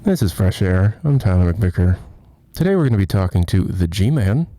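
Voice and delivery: loud, low, deliberate public radio announcer; mock radio host. Streamer pretending to host a radio show, mock announcer. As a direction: announce it like a serious interview host.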